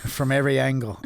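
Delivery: put-on botched Scouse accent slipping into Irish; Irish-sounding Scouse attempt. Reading an insult to co-host, accidental Irish accent. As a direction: try Scouse, land in Irish. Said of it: "Why am I Irish?".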